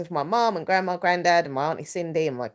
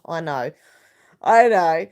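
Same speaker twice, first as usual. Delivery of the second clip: usual speaking voice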